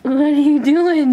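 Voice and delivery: playful. Real, not acted. Playful tease of baby.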